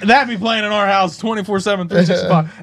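loud, high hyped-up praise; excited. Retold or imitated. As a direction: hype it like a banger. Mocking kid song fandom.

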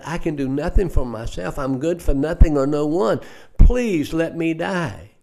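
desperate pleading. Retold or imitated. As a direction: beg as if you have nothing left. Despairing old lady pleads.

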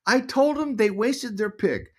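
high, slow imitation of mom; defiant mom. Mom answering her son, high-pitched defiance. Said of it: "What'd you tell 'em?".

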